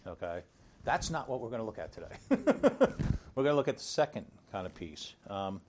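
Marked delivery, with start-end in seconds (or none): 2.2-3.3 laughing. Real, not acted. Laughs after joke.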